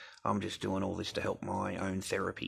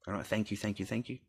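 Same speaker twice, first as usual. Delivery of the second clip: normal talking voice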